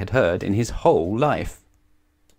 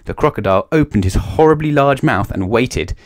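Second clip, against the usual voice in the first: plain reading voice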